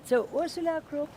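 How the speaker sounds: loud call to a straggler. Real, not acted. Guide calls out to a lagging tourist, loud call. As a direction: call her name across the group.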